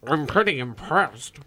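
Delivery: talking through a mouthful of hair; words muffled by a full mouth. Real, not acted. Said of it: "your mouth is full of hair".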